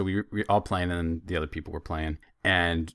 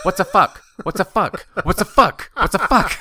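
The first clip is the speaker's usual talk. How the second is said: put-on high, loud little girl asking over and over; imitating an eager small daughter; high mock child repeating a question; curious little girl; 11 semitones higher, 9 dB louder than usual. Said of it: "She's like".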